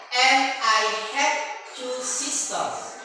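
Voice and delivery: very slow, loud English sentence; loud model line. Shown as a demonstration. Teacher leads final repeat, slow and loud. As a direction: draw it out loud for the class to copy. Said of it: "angkat suaranya".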